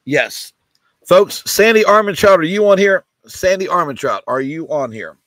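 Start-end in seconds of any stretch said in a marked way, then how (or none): 1.1-3.0 louder calling out a buyer's name. Real, not acted. Host calling out for a missing buyer, loud call. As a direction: call out across the room for Sandy.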